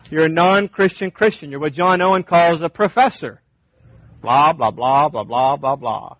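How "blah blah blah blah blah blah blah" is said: mock droning of empty talk; rhythmic, dismissive babble; dismissive blah-blah. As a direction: drone it out mockingly, sinking away at the end. Mimics empty professing talk.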